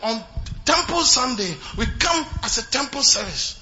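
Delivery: loud, high fervent preaching; preaching at full force. Acted. Pastor urging the congregation to win souls, fervent and loud. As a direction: drive the point home with fire.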